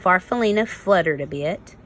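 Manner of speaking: ordinary delivery, nothing marked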